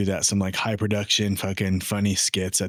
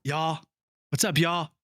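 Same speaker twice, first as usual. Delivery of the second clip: put-on Southern accent; 6 semitones higher than usual. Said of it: "do a shitty Southern accent".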